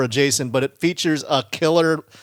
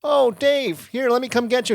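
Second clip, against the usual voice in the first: much higher-pitched mock girlfriend voice; imitating concerned girlfriend; 11 semitones higher, 3 dB louder than usual. Girlfriend calls to her lost boyfriend, high imitated concern. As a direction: voice her coming to the rescue. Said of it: "and she goes".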